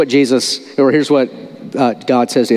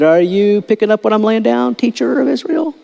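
teasing; 8 semitones higher than usual. Retold or imitated. Jesus teasing Nicodemus.

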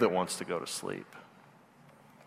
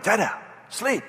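put-on higher, cross little-girl command; mad toddler; 9 semitones higher than usual. Toddler scolds dad for waking, higher and cross. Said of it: "she'll get mad".

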